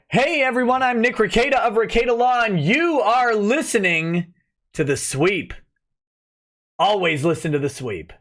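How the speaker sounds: big intro voice, stretching the last phrase; improved show intro. Shown as a demonstration. Streamer recording the intro promised to a viewer, hyped announcer. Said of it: "let me do one better".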